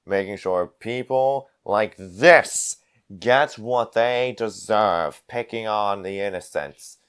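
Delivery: slow, deliberate brawler, softening at the end; menacing avenger. Acted. Avenger accusing the gnoll of harming innocents, slow menace. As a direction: draw out each phrase as a veiled threat.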